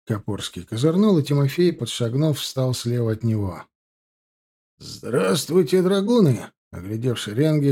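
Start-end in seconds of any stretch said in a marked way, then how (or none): 4.8-6.5 high, drawn-out officer's greeting shout; officer calling out to his men; hoarse shout. A narrator's reading. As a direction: greet the troops across the parade ground. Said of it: "хрипло выкрикнул подпоручик".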